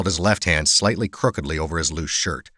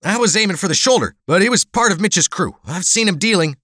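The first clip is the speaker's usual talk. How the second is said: fast, high whining self-justification; whiny; 13 semitones higher, 4 dB louder than usual. Acted. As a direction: whine your excuse, pitched way up and hurried. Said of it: "replied in a whiny tone".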